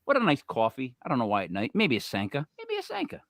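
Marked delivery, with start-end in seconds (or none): 2.6-3.2 high, soft mock mom voice. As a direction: mimic mom in a high, hushed voice. Mom's suggestion, retold and then mocked.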